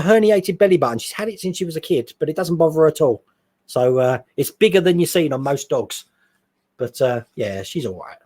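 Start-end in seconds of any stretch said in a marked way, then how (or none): none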